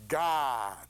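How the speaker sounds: a touch of a solemn divine voice; God's voice. Acted. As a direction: announce it from above. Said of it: "a voice says".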